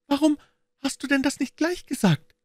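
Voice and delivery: put-on high-pitched child, relieved outburst; very high, bright-spirited little-kid voice; little girl exclaiming. A narrator's reading. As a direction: cry out as a suddenly cheered small girl. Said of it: "rief die Kleine und lachte hell auf".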